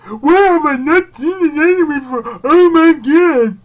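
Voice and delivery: high, loud mock fan voice; mock outraged fan. Vlogger mocking a fan shocked at his anime gap, high and loud.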